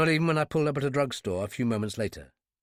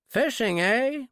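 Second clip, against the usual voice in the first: high, louder folksy clerk; friendly small-town nosiness; drugstore clerk; 11 semitones higher, 3 dB louder, slower than usual. Acted. Drugstore clerk chats up the stranger buying cigarettes.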